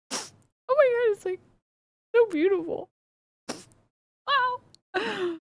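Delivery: high, breathy swoon of wonder; mock swooning awe. Streamer gushing over a romantic doujinshi, high swooning awe. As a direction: gush like a moved fangirl.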